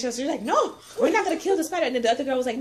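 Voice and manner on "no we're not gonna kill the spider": put-on coworker's Spanish accent, lightly done; a hint of Spanish accent on the refusal. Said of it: "She's Spanish".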